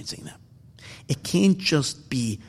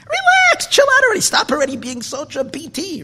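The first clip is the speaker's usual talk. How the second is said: much higher, louder mock voice telling him to relax; mock exasperated plea; 13 semitones higher, 6 dB louder than usual. Teasing an overzealous Baal Tshuva, high mock exasperation. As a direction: play the fed-up friend, squeaky and pushy. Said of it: "And you say".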